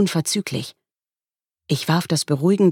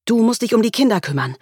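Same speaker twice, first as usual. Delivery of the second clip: hurried, higher-pitched instructions; speaking fast; 8 semitones higher, faster than usual. A narrator's reading. Mother instructing her husband before the hospital, fast. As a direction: rattle it off urgently. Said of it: "Ich begann, schnell zu sprechen".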